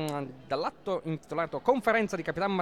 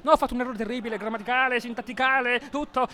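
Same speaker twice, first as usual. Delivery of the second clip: mock dismay; 7 semitones higher, faster than usual. Real, not acted. Mock horror at own mistake.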